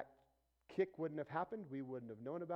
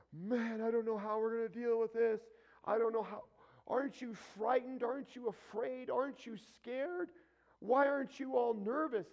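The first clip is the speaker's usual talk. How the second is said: higher, louder panicky questions; mock panic; 9 semitones higher, 7 dB louder than usual. Worried people pressing the calm believer, mock panicked. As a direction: play the frightened onlooker, pleading. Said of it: "panicking and freaking out".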